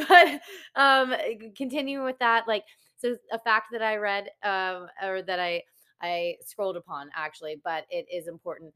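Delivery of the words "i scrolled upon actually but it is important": ordinary talk, nothing marked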